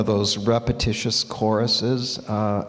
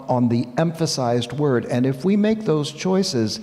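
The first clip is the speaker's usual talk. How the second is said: usual speaking voice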